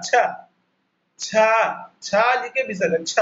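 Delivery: छ said with force and a breathy release; stressed chha. Shown as a demonstration. Teacher stresses छ for the Hindi six. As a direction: hit छ hard with a visarga puff. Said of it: "छ के ऊपर पर थोड़ा जोर देकर".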